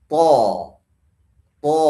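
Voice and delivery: TO as a heavy open aw, lips unrounded; thick fathah. Shown as a demonstration. Teacher giving the correct letter, thick open TO. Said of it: "yang tebal bacanya".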